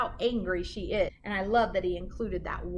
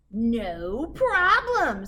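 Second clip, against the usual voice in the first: slow, drawn-out, louder witch; 7 dB louder, much slower than usual. A narrator's reading. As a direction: stretch it out smugly like a confident witch. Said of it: "said Gritch".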